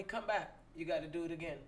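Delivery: mother's order. Retold or imitated. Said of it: "my mother would tell me".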